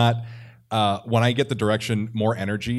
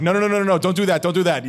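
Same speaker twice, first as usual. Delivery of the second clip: higher, louder rapid protest; urgent objection; 8 semitones higher, 6 dB louder, faster than usual. Retold or imitated. Retold director halting a shouting actor, urgent protest. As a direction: cut in to stop them.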